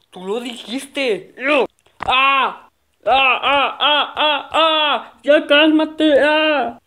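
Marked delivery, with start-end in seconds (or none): 2.0-6.8 high, loud yells with laughter; hammed-up brawl cries; fight yelling. Two kids fighting over the chicken, loud yelling.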